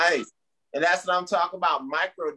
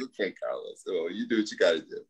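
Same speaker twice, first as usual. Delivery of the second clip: low mock voice; 9 semitones lower than usual. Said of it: "I'm like".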